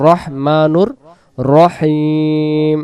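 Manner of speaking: slow, stretched recitation. Acted. Teacher reciting the phrase slowly for students. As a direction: draw it out slowly.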